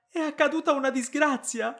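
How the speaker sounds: "accaduta" cried out high and loud in alarm; panicked cry. Acted. Wife fears disaster struck her brother, crying out in panic. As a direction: shriek "disgrazia" in sudden fear.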